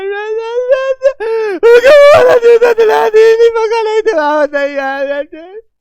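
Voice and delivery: put-on high wailing child's sob, pleading for shoes; bawling child demanding shoes; whining child crying. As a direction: cry like a spoilt kid begging his father. Child throws a tantrum for shoes.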